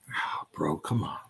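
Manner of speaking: soft, whispered plea; coaxing sigh. Retold or imitated. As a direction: breathe it out to a stubborn brother. Brother gently coaxing him to relent.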